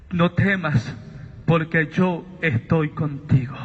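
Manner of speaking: low, deep, calm reassurance; old man's comfort. Retold or imitated. The old man reassures Sabino, low and calm. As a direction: speak it low like an old man soothing a frightened son.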